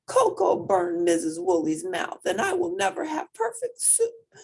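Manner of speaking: mild tearful complaint; with a sob. A narrator's reading. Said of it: "Murray said with a sob".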